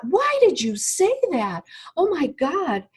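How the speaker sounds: high, incredulous why; stunned disbelief. Retold or imitated. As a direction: question yourself in shocked disbelief. Shocked at own outburst.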